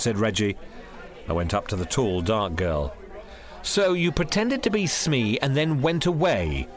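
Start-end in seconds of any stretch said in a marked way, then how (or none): 3.6-6.7 slow, high-pitched challenge; pointed, raised-pitch accusation; accusing. A narrator's reading. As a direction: accuse with a drawn-out question. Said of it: "I accused her".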